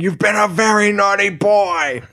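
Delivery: high-pitched hammed-up yell at a naughty boy; mock yell. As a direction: scold like an angry keeper, half-joking. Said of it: "they're just screaming".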